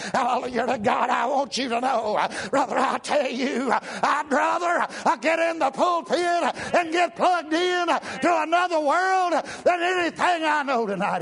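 high, shouted preacher's climax; full-force preaching. Acted. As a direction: build to a ringing cry.

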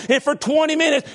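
high, loud revival preaching; fiery preaching. Acted. Preacher stirring up his congregation, fiery and loud. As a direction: preach it hard to the pews.

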